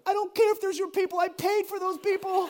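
high, loud mock-angry retort; exasperated dad. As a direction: act out a dad losing patience. Exasperated father answering daughter.

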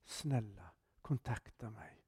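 soft, secret whisper into an ear. Retold or imitated. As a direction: hush it like a plea only one person hears. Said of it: "viskar han mig i örat".

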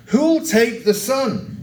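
high, drawn-out auctioneer's call. A narrator's reading. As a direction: call slowly across the room for a bid. Auctioneer continues calling for bids.

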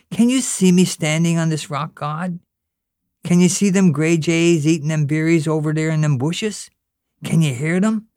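put-on high, earnest little boy talking to God; a child pleading, all wide-eyed; boy at his first real prayer; young boy Pat praying. Pat's long prayer to God.

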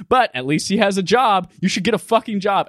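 half-yell it as a telling-off; mild mock yelling. Item description berates player for having no job, mock yell. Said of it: "just yelling at you".

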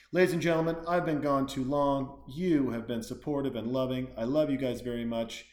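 loud, projected show outro; punchy plug-reading announcer delivery; announcer sign-off. Real, not acted. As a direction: address the crowd like an emcee. Gives the show's sign-off plugs.

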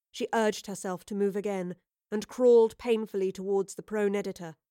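usual speaking voice

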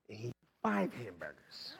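low, soft emphasis on five. Retold or imitated. Big portion set against little ones.

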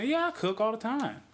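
high, breezy imitation of a woman boasting; lightly mimicking a girlfriend; mock woman bragging. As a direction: play her up as the perfect homemaker.

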